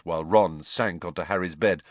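ordinary delivery

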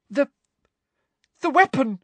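high, faltering voice; Hermione's high, broken stammer; tearful stammer. A narrator's reading. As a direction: stumble out the word through tears.

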